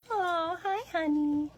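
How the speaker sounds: high sweet coo; cooing hello. Real, not acted. Shelter worker greeting a cat, high sweet coo.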